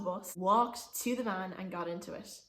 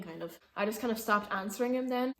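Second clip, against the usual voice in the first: ordinary talk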